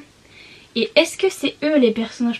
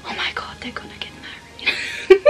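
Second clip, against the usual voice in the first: excited whispered aside; 9 semitones higher than usual. Real, not acted. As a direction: whisper breaking into a giggle. Aparté excité pendant la lecture. (chuchotement excité et rieur)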